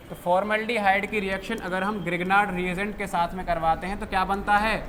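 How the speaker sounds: normal talking voice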